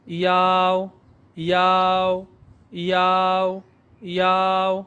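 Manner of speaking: یاو drawn out as ya gliding into o; iao sound. Shown as a demonstration. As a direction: slide یاو from i through a to o. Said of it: "آئی اے او کی آواز ہے". (یاو، آئی اے او کی کھنچی ہوئی آواز)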